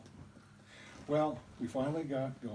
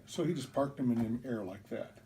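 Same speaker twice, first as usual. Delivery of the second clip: usual speaking voice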